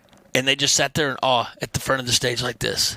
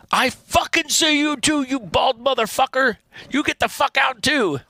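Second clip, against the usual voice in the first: angry yell; 10 semitones higher than usual. Retold or imitated. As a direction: scream at the bald guy to get out. Guitarist yelling at him.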